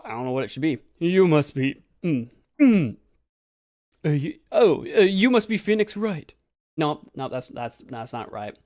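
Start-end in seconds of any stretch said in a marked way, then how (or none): 1.0-6.3 first stab at Acro's voice, louder; trying out a voice. Shown as a demonstration. Gamer hunting for Acro's voice, trying it out. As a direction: try on a voice for the character. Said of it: "I gotta have a voice for you".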